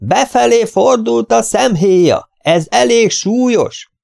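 slow, high-pitched shouting. A narrator's reading. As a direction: let the voice out, word by word. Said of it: "kieresztettem a hangomat". (kieresztett hangon, lassan)

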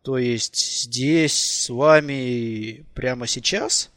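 slow, halting question; flustered hesitation. A narrator's reading. Fili checking with Nicole, slow and hesitant. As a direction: stretch it out, unable to believe it.

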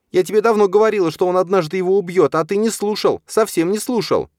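fast, high, loud berating; scolding tirade. A narrator's reading. Stepmother berating her husband for not listening, scolding. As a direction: rattle off furious reproaches at top pitch. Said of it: "чистила Мацоха".

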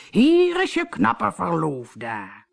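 slow, drawn-out suitor's call. A narrator's reading. Captain announces himself at the door, calling out. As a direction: stretch it out as a pompous announcement. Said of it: "hoorde ze de kapitein roepen".

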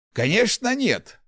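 high-pitched, lightly scoffing denial; amused scoff. A narrator's reading. Old inventor denies giving up to the rector, scoffing. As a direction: toss it off with a snort in the voice. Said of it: "хмыкнул Ларс".

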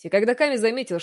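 usual speaking voice

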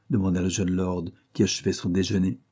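ordinary delivery, nothing marked

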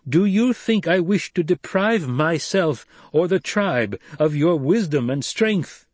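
high, slow, emphatic appeal; Paul, impassioned leader. A narrator's reading. Paul appealing to Stilgar for his wisdom, impassioned. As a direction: appeal to him with force.

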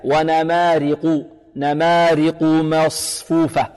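slow نمارق with light r and full, dark q; measured recitation of نمارق with emphatic q; heavy qaf. Shown as a demonstration. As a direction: chant ونمارق, thickening only the qaf. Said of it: "والقاف مفخمة".

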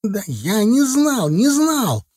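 very high, drawn-out pleading; panicked protest. A narrator's reading. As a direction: plead innocence in a high, frantic voice. Titok protests while attacked.

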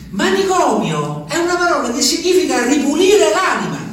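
Gemma imitata, voce molto più acuta, quasi cantilenata. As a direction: fai il verso a Gemma, acuta e insistente. (lui rifà la voce di Gemma che lo rassicura, acuta)

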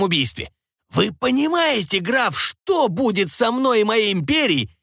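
high, alarmed emperor; anxious emperor voice. A narrator's reading. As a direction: press the count in rising alarm.

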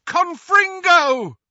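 very loud, high, drawn-out incantation; long explosive battle cry; yelled spell. A narrator's reading. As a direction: yell the spell at full force. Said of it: "and yelled".

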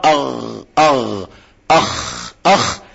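low, soft أغ then rasped أخ, each held; throat-letter demo. Shown as a demonstration. Teacher shows where letters come from, throat-letter demo. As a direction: isolate the غ and خ after a short vowel. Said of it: "الغين والخاء".

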